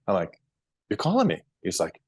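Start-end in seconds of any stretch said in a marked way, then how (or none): 0.9-1.4 surprised. Retold or imitated. Surprise call from the doctor.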